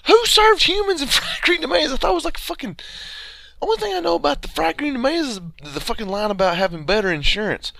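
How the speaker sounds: high-pitched, really baffled disbelief; incredulous outburst. Real, not acted. Friend learns humans were served in Fried Green Tomatoes, incredulous. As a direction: voice jumps up in real disbelief. Outraged disbelief at film's barbecue plot.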